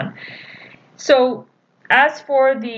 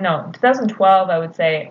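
ordinary talk, nothing marked

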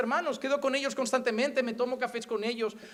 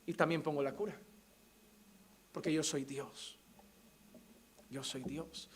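God's hushed sovereignty; 8 semitones lower, 11 dB quieter than usual. Acted. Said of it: "Él es el que voy a Él y me dice".

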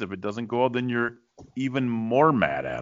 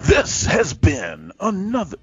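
late night DJ; 11 semitones higher, 5 dB louder, slower than usual. Acted. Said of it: "it's like a late night D J voice".

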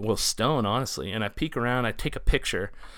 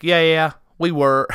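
high, loud Southern Ohio twang; Southern Ohio accent; 6 semitones higher, 8 dB louder than usual. Retold or imitated. Waitress confirming to a customer, lightly put-on Southern Ohio accent. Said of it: "that's how everyone talks down Southern Ohio".

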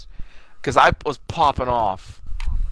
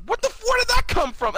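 stunned disbelief. Retold or imitated. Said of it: "I'm like".

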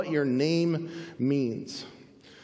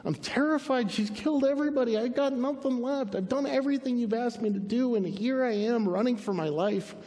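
high, self-pitying lament; acted plaintive outpouring, high-pitched; pity party; 6 semitones higher than usual. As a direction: whine it out as frightened Elijah. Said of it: "Elijah goes back into his pity party".

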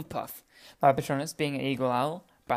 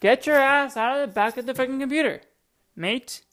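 scolding; 9 semitones higher, 6 dB louder than usual. Real, not acted. Scolding cat behind computer.

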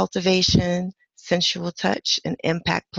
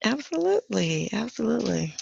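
sultry voice; 4 semitones higher, 5 dB quieter than usual. Acted. [name] in sultry host voice.

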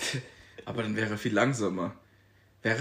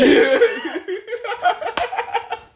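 long shrieking laugh; high loud laugh; 7 dB louder than usual. Real, not acted. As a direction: burst into a high cackle. Loud laugh at own joke.

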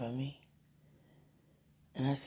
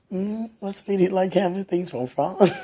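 laughing; 3 semitones higher, 9 dB louder than usual. Real, not acted. Teasing partner while laughing.

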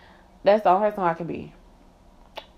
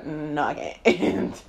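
drawn-out refusal; 3 semitones lower, much slower than usual. Retold or imitated. Slow put-on refusal.

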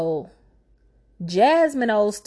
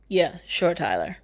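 normal talking voice

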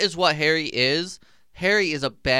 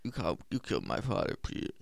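a hint of a bitter Harry, soft and fast; imitating Harry; 2 semitones lower, 8 dB quieter, much faster than usual. Friend imitating Harry's accusation, softly mocking. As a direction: mutter it fast like a grieving son. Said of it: "That's what he sounded like".

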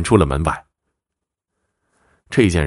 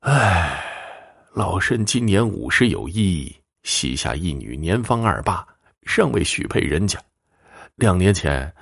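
put-on sighing old woman. Old mother consulting the fortune-teller about her daughter, sighing. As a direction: open on a sigh and confide your troubles. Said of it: "就先叹了一口气".

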